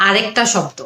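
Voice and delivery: ordinary talk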